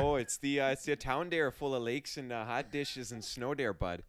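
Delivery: a light, folksy Minnesotan lilt, slowed down on hot dishes and snow; Minnesotan accent. Pretend. Host answers the guest's teasing in a light Minnesotan accent. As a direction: play a friendly small-town Minnesotan. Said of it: "I love Minnesotan accents".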